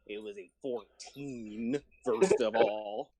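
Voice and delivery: pirate voice. Pretend. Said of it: "my only pirate voice, Arg".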